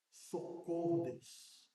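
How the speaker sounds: quiet, breaking cry for help; desperate plea. Shown as a demonstration. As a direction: plead to God under your breath. Grito de socorro sincero a Deus. (súplica sussurrada e desesperada)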